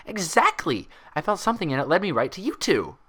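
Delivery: high, bubbly pony voice, puzzled; Pinkie voice. Acted. Pinkie asking Twilight about her hair, high and bubbly. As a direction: bounce up high and curious.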